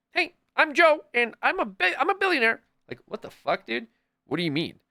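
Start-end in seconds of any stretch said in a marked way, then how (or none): 0.1-2.6 much higher, louder goofy voice; mock the bland rich guy in a silly high voice; mocking boring billionaire. Mocking a boring billionaire to friends, high and goofy. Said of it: "walking around like".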